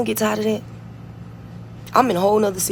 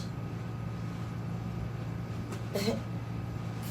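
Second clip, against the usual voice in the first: breathy laugh; 4 semitones lower, 15 dB quieter than usual. Real, not acted. Laughs after insult.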